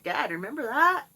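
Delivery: teasing her dad about begging. Retold or imitated. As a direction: needle him playfully. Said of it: "I'm like".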